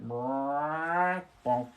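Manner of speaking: long croak, then short bonk; imitated frog croak. Streamer copying the frog by the pool, drawn-out croak. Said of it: "if you hear that noise in the background".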